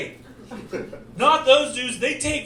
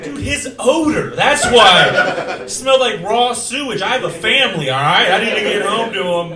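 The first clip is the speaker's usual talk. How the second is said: 1 indignant excuse; 2 semitones higher, 8 dB louder than usual. Retold or imitated. Defeated fighter excusing loss.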